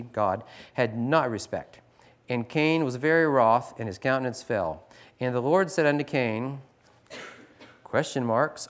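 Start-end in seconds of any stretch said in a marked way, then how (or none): 0.7-6.6 louder formal Bible reading; scripture reading. Acted. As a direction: read the old verses with weight. Reads King James scripture aloud.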